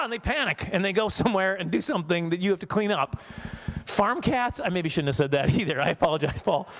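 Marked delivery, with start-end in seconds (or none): none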